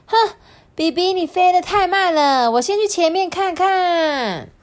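high, loud acted impatience; huff, then complain high and push ahead; impatient boasting. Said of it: "不等比比说话哦". (不耐烦地高声抱怨)

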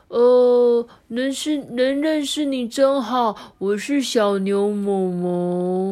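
slow, halting little calf voice. Acted. The calf meeting Hulu, slow and halting. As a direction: stumble gently over the greeting.

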